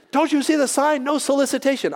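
high, irritated scolding; mock livid. Livid resident confronts door-to-door evangelists, mock indignant scolding. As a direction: imitate an indignant man at his door. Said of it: "he's livid again".

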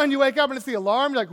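usual speaking voice

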